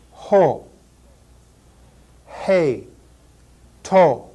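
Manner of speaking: Ho, Hey, To said cleanly with breathy h; Greek articles. Shown as a demonstration. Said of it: "three different forms of the definite article".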